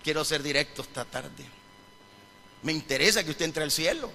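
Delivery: ordinary delivery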